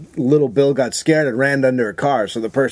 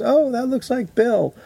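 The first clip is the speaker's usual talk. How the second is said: lightly voiced wife, higher and drawn out; wife's remark; 10 semitones higher than usual. Wife spotting a dog like Bill, lightly imitated higher voice. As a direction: retell it in a high, slow voice. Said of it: "And my wife goes".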